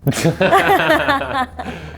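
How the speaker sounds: loud, high burst of laughter; real laugh bursting out. Real, not acted. Guest asked for good Messi memories, laughing.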